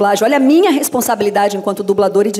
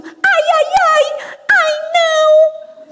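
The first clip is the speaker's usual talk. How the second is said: very high, squeaky cartoon fretting; cute high-pitched dismay; Minnie; much slower than usual. Dubber performing her Portuguese substitute phrase, squeaky cartoon voice. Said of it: "a Mini, em português, eu falo muito".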